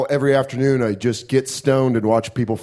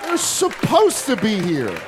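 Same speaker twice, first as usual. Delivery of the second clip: high-pitched mocking comeback; mock high laughing retort; 9 semitones higher, slower than usual. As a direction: fire it back high and gleeful. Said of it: "You're like".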